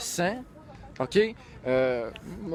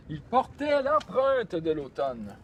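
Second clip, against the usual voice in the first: slow, drawn-out poem reading; stagy recitation, stretching the words; poetry recital; 2 semitones higher, slower than usual. Acted. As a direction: declaim the line slowly, like verse. Reciting a poem line aloud.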